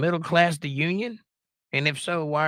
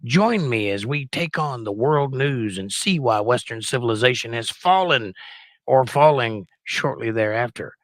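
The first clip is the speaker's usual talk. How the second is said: over-the-top newscaster welcoming the audience; announcer voice; 6 dB louder than usual. Acted. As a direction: boom it out like a TV host opening the broadcast. Mock newsman opening the show.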